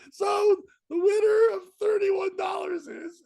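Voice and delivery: high, slow, laugh-strained announcer call; laughing announcement. Real, not acted. Calling the raffle winner to the bikers, laughing. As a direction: announce it while cracking up.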